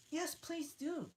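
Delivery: put-on high, slow pleading girl voice. A narrator's reading. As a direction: beg politely. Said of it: "pleaded Alice".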